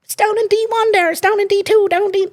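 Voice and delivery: imitating them in a silly high voice; mock high voice. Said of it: "They're all like".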